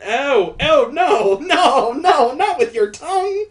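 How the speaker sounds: loud, high cries of dismay; horrified protest. Real, not acted. Reader begging her not to use her tongue, horrified. Reader recoils at licked tears.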